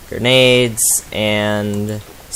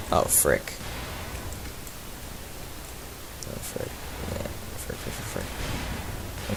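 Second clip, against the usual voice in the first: whispered cursing; 8 semitones lower, 17 dB quieter than usual. Real, not acted. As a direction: whisper your panic under your breath. Hiding from a sound-hunting creature.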